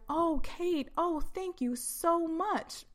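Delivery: high, slow syrupy thanks; fake politeness. Speaker mocking a phone call to Kate, fake polite. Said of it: "I don't talk like that".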